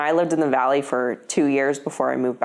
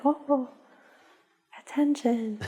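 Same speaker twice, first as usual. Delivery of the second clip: voicing the dog; 9 semitones higher, 5 dB quieter than usual. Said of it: "she'll be like".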